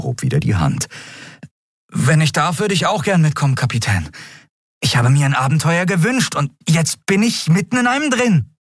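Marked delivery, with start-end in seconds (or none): 1.9-8.6 high, pleading eagerness; eager young crewmate. A narrator's reading. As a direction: beg the captain to let you come along. (eifrig bittend, mit hoher Stimme)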